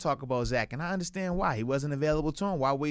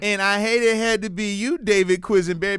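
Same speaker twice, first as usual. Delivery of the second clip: mock trash talk; 4 semitones higher, 7 dB louder than usual. Calling out opposing lineman.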